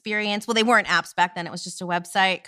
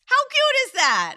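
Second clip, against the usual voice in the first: gushing; 4 dB louder, slower than usual. Real, not acted. Gushing over a sweet card.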